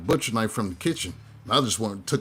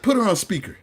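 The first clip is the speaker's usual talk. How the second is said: loud, higher demand to put her on speaker; retold yell; 9 semitones higher, 5 dB louder than usual. Son telling dad to put mom on speaker, loud. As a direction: bark it at him, high and loud. Dream, ordering mom on phone.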